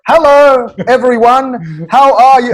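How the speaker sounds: slow, high, over-enunciated greeting; careful foreigner-friendly English. Retold or imitated. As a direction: greet them loudly and slowly, as if teaching beginners English. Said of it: "don't speak in an Australian accent".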